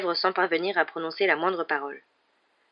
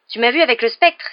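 high, loud excited boast; crying out; 6 semitones higher, 7 dB louder than usual. A narrator's reading. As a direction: show off loudly to classmates. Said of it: "s'écria Simus". (exclamation excitée, haute et forte)

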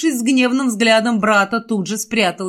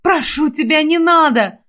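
high, loud pleading cry; desperate plea; 7 semitones higher, 4 dB louder than usual. A narrator's reading. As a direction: beg for his life, desperate. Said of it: "с отчаянием в голосе закричала она".

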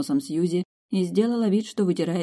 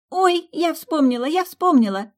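very high delighted squeal; joyful squeal; 12 semitones higher, 5 dB louder than usual. A narrator's reading. Kendra remembering the name, joyful high squeal. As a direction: squeal it like a schoolgirl. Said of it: "радостно завизжала".

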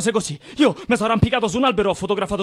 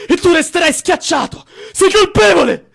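furious accusing shout; 7 semitones higher, 6 dB louder, slower than usual. Acted.

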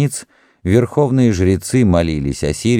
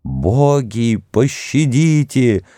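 wailing crowd; 7 semitones higher, slower than usual. Acted. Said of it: "завопила толпа".

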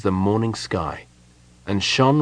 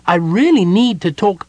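high, loud character voice; pleading kender; 10 semitones higher, 6 dB louder than usual. A narrator's reading. Tass begs to talk through the door, pleading. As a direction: plead loudly in a high little voice.

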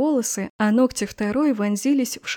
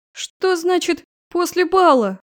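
higher, louder, slow indignant question; outraged disbelief; 6 semitones higher, 4 dB louder, slower than usual. Acted. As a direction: flare up in disbelief. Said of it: "Взвилась драконица".

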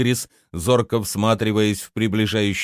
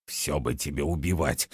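low, soft, frowning mutter; disapproving grumble; 7 semitones lower, 8 dB quieter than usual. A narrator's reading. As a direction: grumble disapproval under your breath. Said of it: "нахмурился колдун". (хмуро ворча, низко и тихо)

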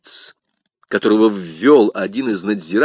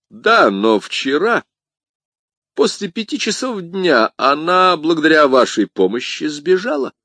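raised-pitch accusation; pressing commissar; 7 semitones higher, 4 dB louder than usual. Acted. Commissar confronts Bonacieux over his wife's escape, pressing. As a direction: press the charge with rising emphasis.

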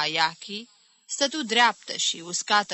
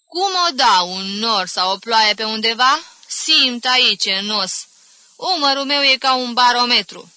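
loud, raised, pleased lament; exaggerated suffering, self-satisfied; 5 semitones higher, 7 dB louder than usual. Rheumatic aunt explaining her shoulder to the others, exaggerated suffering. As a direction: declare your aches like proud proof. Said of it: "cu suferințe exagerate și satisfacție științifică".

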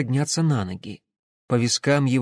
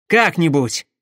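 curt, loud, raised retort; snapping back; 4 semitones higher, 6 dB louder than usual. A narrator's reading. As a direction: snap at a friend's worry. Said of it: "огрызнулся Пахомов". (раздражённо огрызаясь)

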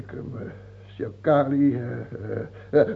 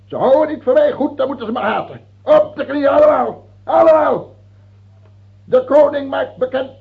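screamed high and loud; furious shout; 10 semitones higher, 10 dB louder than usual. Acted. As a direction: bellow at the courtiers to kneel. King screams commands at courtiers. (schreeuwend, hoog en luid bevelend)

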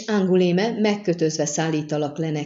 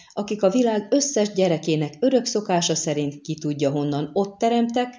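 plain reading voice

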